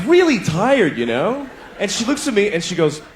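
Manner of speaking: ordinary talk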